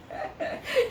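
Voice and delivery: airy giggle; high breathy laugh. Real, not acted. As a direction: laugh lightly at yourself. Laughing after praising.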